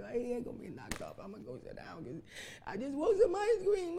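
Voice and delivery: put-on soft, sulky little kid's whine rising to a petulant cry; whining child. Preacher voicing a sulking child denied ice cream. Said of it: "You'd be like".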